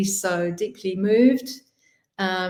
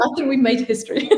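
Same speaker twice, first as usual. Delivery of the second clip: fast, higher, laughing through it; 5 semitones higher, much faster than usual. Real, not acted. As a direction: rush it out, giggling. Giddy ending.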